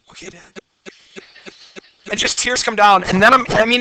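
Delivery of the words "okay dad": very soft, small hurt answer; meek child's reply. Retold or imitated. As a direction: answer Dad in a tiny, crushed voice. Hurt three-year-old answers scolding.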